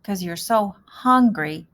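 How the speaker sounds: plain reading voice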